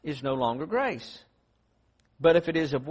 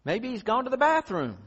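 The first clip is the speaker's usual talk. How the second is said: taunting; 6 semitones higher than usual. Retold or imitated. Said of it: "Paul taunted them".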